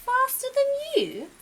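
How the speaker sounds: put-on baby owl amazed at the deer; amazed little owl. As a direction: gasp it out high and loud.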